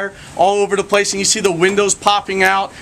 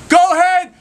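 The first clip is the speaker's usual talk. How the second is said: high, loud, slow shout upward; firemen yelling up; 3 dB louder than usual. Acted. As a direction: bellow up to the lady on the roof. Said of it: "they're yelling up".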